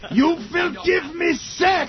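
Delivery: hammed-up German accent, high and slow; mock German accent. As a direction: order it like a German vamp.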